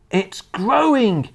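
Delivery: high, long-stretched cry; excited boy's yell. A narrator's reading. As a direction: call it out in amazement, drawing out the word. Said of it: "he yelled".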